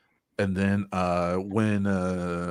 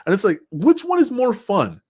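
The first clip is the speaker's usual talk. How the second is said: excited challenge; 12 semitones higher, 7 dB louder than usual. Real, not acted.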